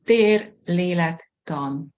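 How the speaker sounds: measured, careful pronunciation with clear pauses; slow enunciation. Shown as a demonstration. Guest pronouncing the Hungarian term for the host, slow and careful. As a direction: say each word slowly and separately. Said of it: "say it slowly".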